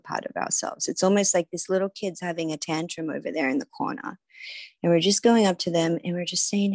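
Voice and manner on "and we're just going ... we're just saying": ordinary talk, nothing marked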